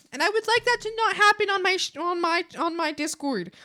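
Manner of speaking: high, loud and fast, pushing the point; emphatic insistence. Real, not acted. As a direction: insist hard, voice shooting up. Firm wish against hate raids.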